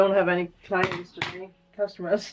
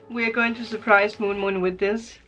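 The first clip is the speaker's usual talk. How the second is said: ordinary delivery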